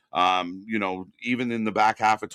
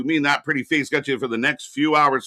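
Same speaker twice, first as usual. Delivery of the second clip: ordinary delivery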